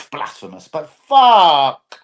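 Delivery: ordinary talk, nothing marked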